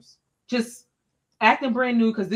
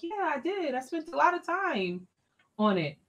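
higher, eager insistence; teacher defending her lesson to the principal, higher and earnest; earnest defense; 6 semitones higher, 5 dB quieter than usual. Retold or imitated. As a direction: insist you worked hard, pitch up.

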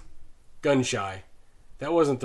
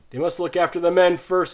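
plain reading voice

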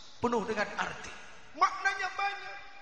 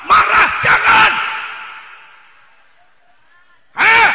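very loud, slower, higher shout; full shout; 8 semitones higher, 18 dB louder, slower than usual. Acted. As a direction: roar it in exaggerated anger. Berteriak marah berlebihan. (teriakan sangat keras pura-pura marah)

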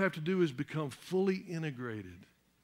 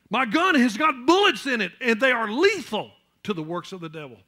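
louder, higher declarations; fervent preaching; 5 semitones higher, 7 dB louder than usual. Acted. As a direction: hammer each claim with conviction. Preaching at full force.

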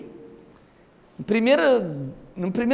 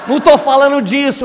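loud, higher mock-exasperated wife; raised-voice retort, played for laughs; retold yelling; 6 semitones higher, 9 dB louder than usual. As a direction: imitate a wife shouting him down. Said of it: "quando ela tava gritando".

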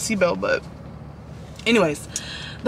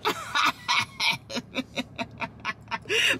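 high rising laugh; 5 semitones higher, 8 dB quieter than usual. Real, not acted. Laughing at trunk.

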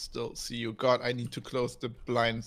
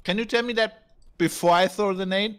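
annoyed; 7 semitones higher, 7 dB louder than usual. Real, not acted. Annoyed at teammate in game.

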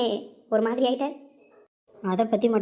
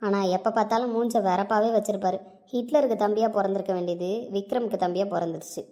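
ordinary talk, nothing marked

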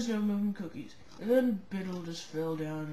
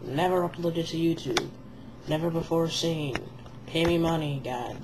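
announcer voice; 8 dB louder than usual. Acted. Mock news announcement opening.

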